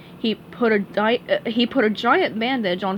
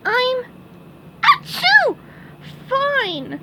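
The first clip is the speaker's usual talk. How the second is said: very high squeak broken by a sneeze; sneeze mid-word; much slower than usual. Acted. SpongeBob insists he is fine, sneeze interrupting.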